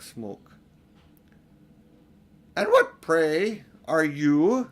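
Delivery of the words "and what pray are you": haughty queen. Acted. Queen questions Edmund.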